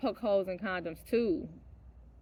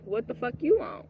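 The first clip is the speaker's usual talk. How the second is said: usual speaking voice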